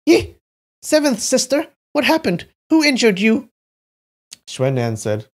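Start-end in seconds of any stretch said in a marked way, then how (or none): 0.1-3.5 high, startled outcry; frightened cry. A narrator's reading. Man finds seventh sister injured, crying out in fright. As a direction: cry out in alarm, pitched well up. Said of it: "He cried out in fright".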